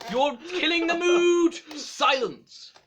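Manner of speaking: loud, high angry outburst; angry shout. Acted. Angry at friends laughing.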